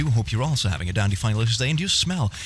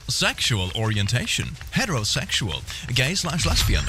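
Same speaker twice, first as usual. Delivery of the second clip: normal talking voice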